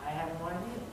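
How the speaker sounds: very soft, low admission; hushed helpless answer. Retold or imitated. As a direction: answer a grieving friend quietly, without answers. Answering a grieving neighbour.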